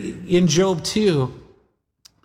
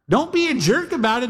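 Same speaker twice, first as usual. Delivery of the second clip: ordinary talk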